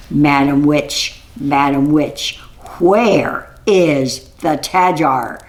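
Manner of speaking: insistent ranger demanding an answer; stern insistence. Acted. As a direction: press each word slowly. Ranger asks the third time.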